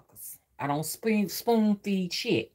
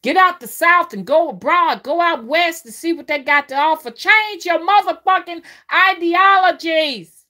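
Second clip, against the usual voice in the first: higher and louder, preaching full force; passionate shout; 10 semitones higher, 9 dB louder than usual. Real, not acted. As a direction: shout it like a sermon to the crowd. Preaching exhortation to listeners.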